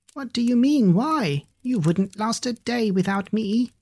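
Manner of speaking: lightly voiced indignant fly, high. Fifi boasts indignantly.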